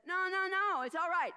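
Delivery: loud, high no no no; loud urgent soothing; loud reassurance. Acted. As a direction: hurry to calm her down. Angel reassures frightened Mary.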